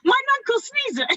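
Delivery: high incredulous echo. Acted. Host echoes the guest's mangled Ebenezer, high in disbelief. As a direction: squeak the name back in disbelief.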